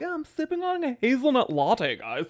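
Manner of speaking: high, prissy put-on voice; mock smug voice. Streamer mocking himself bragging about his latte in a high voice. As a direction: play a smug sipper showing off. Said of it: "And I'm like".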